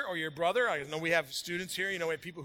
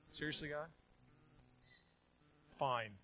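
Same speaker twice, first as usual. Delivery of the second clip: resigned mutter; 3 semitones lower, 12 dB quieter than usual. Retold or imitated. Resigned inner complaint.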